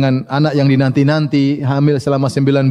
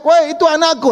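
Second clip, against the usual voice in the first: almost screaming; 12 semitones higher than usual. Retold or imitated. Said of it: "Hampir-hampir dia teriak".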